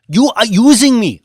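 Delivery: high, loud, furious outburst; angry accusation. A narrator's reading. Mamadou shouting at Banks, furious and loud. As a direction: hurl the accusation at him.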